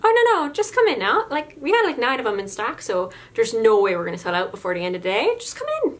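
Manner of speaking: a breezy Newfoundland sales voice; Newfoundland accent. Retold or imitated. Cashier coaxes a caller in a Newfoundland accent. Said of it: "in that voice".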